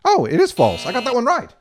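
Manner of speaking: normal talking voice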